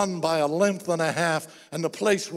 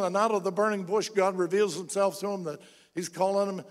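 normal talking voice

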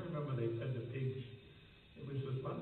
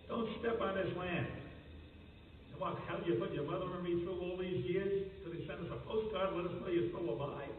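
higher, indignant scolding of a returning son; angry father; 6 semitones higher than usual. Retold or imitated. Ordinary father scolding his prodigal son, mock angry. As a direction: play the ordinary father's mock anger, pitched up. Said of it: "that was the ordinary father, the anger".

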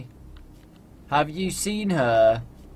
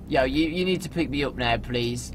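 ordinary talk

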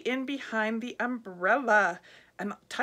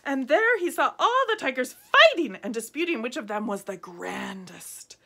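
plain reading voice

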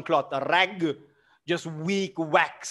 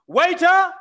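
calling out; 13 semitones higher, 9 dB louder than usual. Acted. Smirnov calling out.

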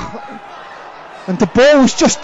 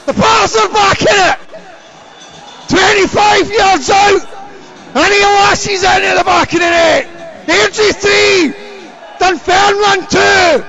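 long goal yell; 9 semitones higher than usual. Real, not acted. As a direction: roar the goal and the score. Equaliser goal celebration.

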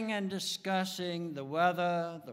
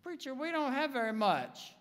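congregant objecting; 5 semitones higher than usual. Acted. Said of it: "you say".

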